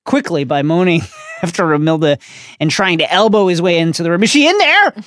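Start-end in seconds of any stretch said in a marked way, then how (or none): 4.2-4.9 very high, loud, eager question; lovestruck eagerness. A narrator's reading. Ron elbowing into the room, frantically eager. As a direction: squeal it with desperate hope. Ron desperate to see Romilda.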